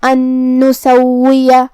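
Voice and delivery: ان نسویہ slowly, noon merged with a nasal hum; ghunna on noon. Shown as a demonstration. Teacher demonstrating idgham with ghunna. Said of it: "غنہ اور تشدید کے ساتھ".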